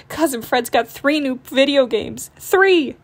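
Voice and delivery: building to a loud, high "Three!"; cried out. Acted. Brother cub bragging about cousin Fred's games, rising to a shout. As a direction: brag harder, top it off with a shout. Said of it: "cried Brother".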